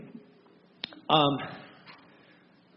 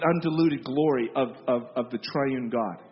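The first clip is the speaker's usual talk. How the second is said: normal talking voice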